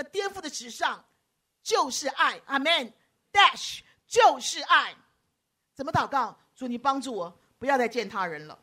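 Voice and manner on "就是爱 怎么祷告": ordinary talk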